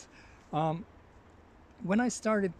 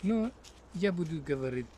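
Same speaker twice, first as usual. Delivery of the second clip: ordinary talk, nothing marked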